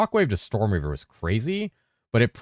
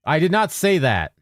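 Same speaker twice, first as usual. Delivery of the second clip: exasperated protest; 10 semitones higher, 5 dB louder than usual. Real, not acted. Voice-to-text program misheard dictation.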